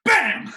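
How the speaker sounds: high loud bang; loud backfire. Retold or imitated. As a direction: burst it like a backfire. Car backfire.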